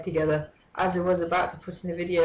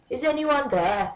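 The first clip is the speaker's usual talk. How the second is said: higher, raised janitor call; mock yell; 7 semitones higher than usual. A narrator's reading. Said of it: "yelled Mister Norris".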